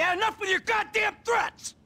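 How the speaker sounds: fed-up yelling back; angry shout. Acted. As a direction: shout him down. Heated quarrel between partners.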